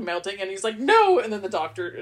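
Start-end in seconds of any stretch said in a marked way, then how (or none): 0.8-1.2 high, loud anguished cry; shouted no. Retold or imitated. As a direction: cry out in horror. Quoting the Doctor's horror.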